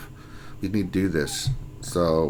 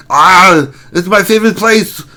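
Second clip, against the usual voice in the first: loud, higher-pitched Austrian strongman; mock Austrian accent; 5 semitones higher, 7 dB louder than usual. Streamer welcomes a fellow Austrian, booming mock Austrian accent. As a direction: boom it out in a hammy Austrian accent.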